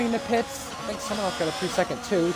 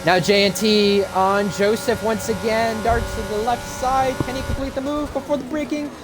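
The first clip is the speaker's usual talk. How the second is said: motorsport commentator; 5 semitones higher than usual. Acted. Live race play-by-play.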